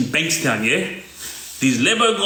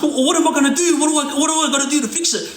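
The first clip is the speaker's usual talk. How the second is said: high, rushed mock panic; retold panic; 9 semitones higher, faster than usual. Scared younger guy begging to fix it, high mock panic. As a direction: plead like you're shaking. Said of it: "like, shaking".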